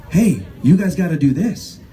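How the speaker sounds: ordinary delivery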